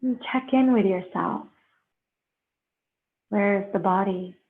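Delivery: slow, unhurried meditation guide with long pauses; guided meditation. Real, not acted. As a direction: ease them into breath and stillness, leaving space between phrases.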